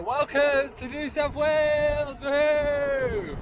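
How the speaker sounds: high, loud whooping welcome; joyful shout. Real, not acted. Cyclist cheers viewers into New South Wales, shouting. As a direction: shout it out to the hills. Celebrating the state crossing.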